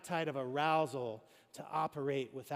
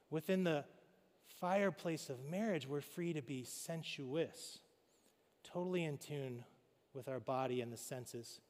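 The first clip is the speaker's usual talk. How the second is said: usual speaking voice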